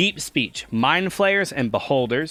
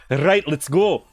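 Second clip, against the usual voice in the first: louder mock Scottish burst; Scottish accent; 2 semitones higher, 5 dB louder than usual. Shown as a demonstration. Showing all the Scottish they can manage, mock Scottish accent. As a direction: punch out a limited Scottish impression. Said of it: "that's all I could say".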